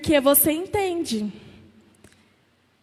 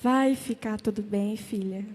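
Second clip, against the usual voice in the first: much lower and softer, calm comfort; tranquil Jesus; 7 semitones lower, 9 dB quieter than usual. Acted. Jesus tenderly calming his daughter, low and soft. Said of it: "Aí Jesus naquela tranquilidade".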